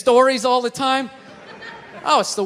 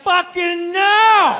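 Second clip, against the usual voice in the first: slow, loud drawn-out no; mock outraged refusal; inner scream; 5 semitones higher, 3 dB louder, much slower than usual. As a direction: shout it inside your head. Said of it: "inside you're like".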